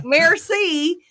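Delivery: "Merci" said flat American, mer-see; American-accented thanks; plain American merci. Shown as a demonstration. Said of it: "I can't just be like".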